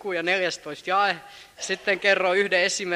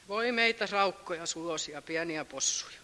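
mock self-pity; 2 semitones lower, 8 dB quieter than usual. Mocking self-pitying believers.

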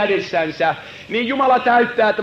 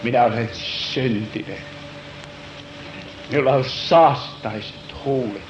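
broken confession; 12 semitones lower, 5 dB quieter than usual. Acted. Sinner confessing to God, broken quiet confession.

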